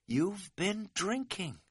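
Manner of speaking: slow, high, hushed disbelief; appalled. A narrator's reading. Hiker realising his friend has been drinking, appalled. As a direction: say it softly, word by word, aghast. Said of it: "I was appalled".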